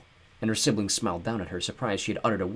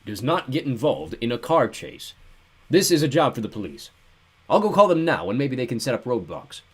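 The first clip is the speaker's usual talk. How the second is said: stern; 3 semitones higher, 4 dB louder than usual. A narrator's reading. Mister Poe refusing a car chase, lightly stern. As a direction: lecture the children firmly. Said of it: "Mister Poe said sternly".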